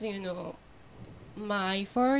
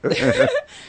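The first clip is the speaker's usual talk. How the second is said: bright burst of laughter; delighted high-pitched laugh; 9 dB louder than usual. Real, not acted. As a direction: break into a giggle. Guest laughs after joke.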